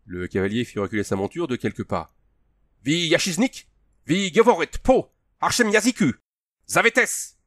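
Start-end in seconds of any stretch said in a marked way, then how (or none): 2.8-7.4 high, loud foreign outburst; alarmed, high-pitched accusation; fearful challenge. A narrator's reading. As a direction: shout with a note of alarm. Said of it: "une note apeurée dans le ton de l'homme".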